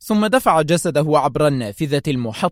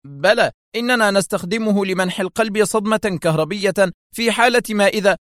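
urgent exclaim; 6 semitones higher than usual. A narrator's reading. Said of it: "هتف بها".

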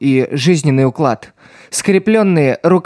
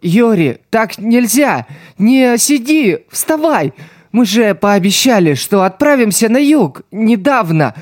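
pitched-up tearful pleading; crying; 6 semitones higher than usual. A narrator's reading. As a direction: beg her through tears. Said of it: "плача говорил он".

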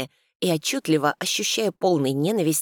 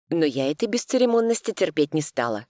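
ordinary delivery

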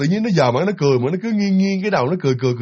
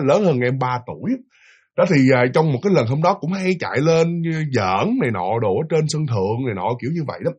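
normal talking voice

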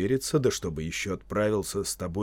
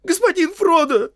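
very high, loud shriek; terrified servant's cry; panicked squealing; 12 dB louder than usual. Acted. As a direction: squeal for your master's help. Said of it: "заверещал Сэм".

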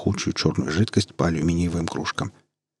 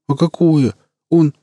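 much higher, louder startled question; russian street thug; 8 semitones higher, 6 dB louder, slower than usual. Acted. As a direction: squeak out the question nervously. Scared thug starts to ask.

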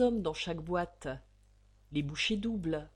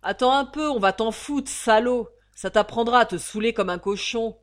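much louder, higher barked threats; furious shout; 4 semitones higher, 12 dB louder than usual. Acted. Guard threatens the drunk prisoner, furious shout. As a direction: yell him back into line.

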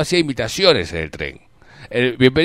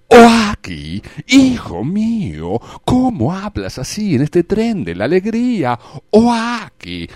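higher, slow whining cartoon voice; Oaki voice; 4 semitones higher, much slower than usual. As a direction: wail like the crying cartoon.

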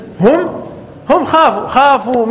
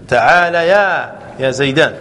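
ordinary delivery

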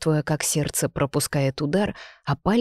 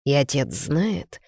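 plain reading voice

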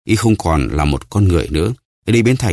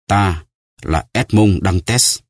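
slow solemn reveal; much slower than usual. Acted. Solemn revelation of true identity.

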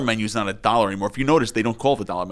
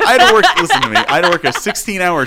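laughing; 7 semitones higher, 6 dB louder than usual. Real, not acted. Laughing while defending himself.

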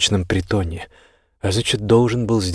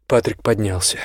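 ordinary delivery, nothing marked

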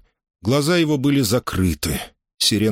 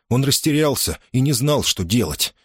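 ordinary delivery, nothing marked

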